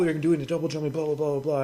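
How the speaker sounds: usual speaking voice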